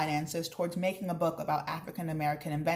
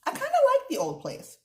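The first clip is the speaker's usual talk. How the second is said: surprised admission; 5 dB louder than usual. Acted. Narrator boy admits liking house.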